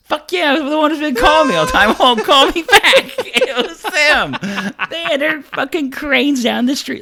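loud, high, laughing disbelief; excited laughing outburst. Real, not acted. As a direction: burst out, half laughing, at the absurd news. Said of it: "they thought it was the funniest".

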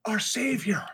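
high, airy mock adoration; hammed-up worship in a high voice; awed crowd. Townsfolk hailing their rescuer, mock awe.

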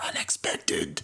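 soft whispered echo. Pretend. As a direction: whisper it back, very softly. Co-host echoes the whispered sign-off.

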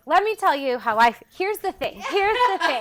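put-on loud, high drunk girl voice; a tipsy nightclub girl holding forth; giddy party girl. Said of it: "That's what we're channeling".

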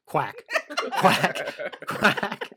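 laughing duck quacks. Pretend. Mighty Ducks fan quacking.

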